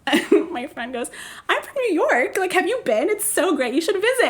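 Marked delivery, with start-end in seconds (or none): 1.5-4.2 much higher, bubbly small talk; fake chatty normal. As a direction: act totally sober and chatty. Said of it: "trying to be ... so normal".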